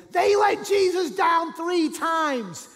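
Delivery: high, slow, full-volume indignation; outraged shout. Acted. Preacher imagining anger at the disciples' failure, outraged shout. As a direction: roar every word as an accusation.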